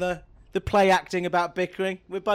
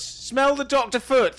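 teasing; 7 semitones higher, slower than usual. Real, not acted. Teasing insistence.

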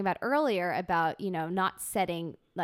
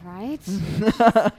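laughing; 11 dB louder than usual. Real, not acted. Host laughing at guess.

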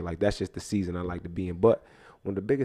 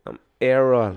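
put-on Jamaican accent; 3 semitones higher, 7 dB louder than usual. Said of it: "my name is Errol".

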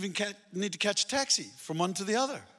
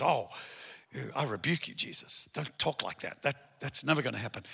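quiet mock rebuke; 7 semitones lower, 8 dB quieter than usual. Peter rebukes Jesus.

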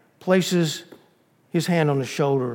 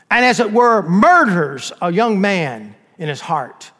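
slow, loud, preacher-like demand for judgment; stern demand; 5 semitones higher, 5 dB louder than usual. Acted. Prosecutor thunders at the judge, slow and loud. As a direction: hammer each charge home.

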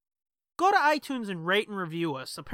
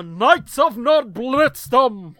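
booming, slow announcer voice; grand announcer; 5 semitones higher, 13 dB louder, much slower than usual. Acted. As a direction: announce it like a title card. Announcing the segment name grandly.

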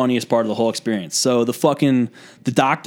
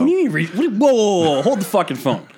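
high sputtering protest that settles down; starts very high, stammering objection; indignant disbelief; 7 semitones higher than usual. Real, not acted. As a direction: cut him off, affronted. Objects to friend's accusation.

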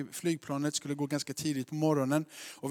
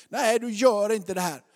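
loud, higher-pitched refusal; wife's objection; 5 semitones higher, 10 dB louder than usual. Retold or imitated. Wife to husband about jumping, loud scolding. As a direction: imitate a wife firmly forbidding it. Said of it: "Det är min frus röst".

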